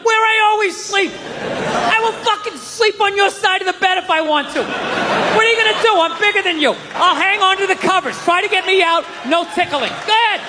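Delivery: defiant boast. Retold or imitated. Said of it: "I'm like".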